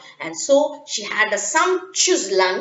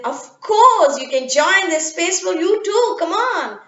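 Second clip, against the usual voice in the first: put-on higher, cheerful girl saying yes; little girl voice; 7 semitones higher than usual. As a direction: welcome him in gladly. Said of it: "Minna said".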